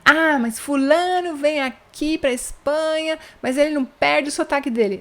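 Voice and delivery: lightly mocking gossip; mock complaint. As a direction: lightly imitate someone griping. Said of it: "Então você fala".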